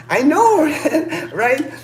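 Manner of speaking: high, loud burst breaking into laughter; laughing agreement. Real, not acted. Agreeing while laughing at remark.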